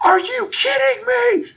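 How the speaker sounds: shrieked high, loud and slow; fan's screech at a missed kick; retold scream. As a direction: scream it at the phone in disbelief. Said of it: "And I scream".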